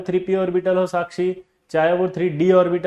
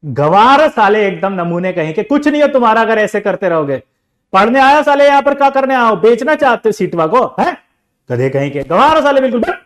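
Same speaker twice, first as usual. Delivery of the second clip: loud, higher abusive scolding; angry teacher scolding; 5 semitones higher, 11 dB louder than usual. Retold or imitated. Teacher scolding pupil, loud furious rant. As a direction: tear into the student. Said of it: "अब देखो teacher क्या कर रहा है".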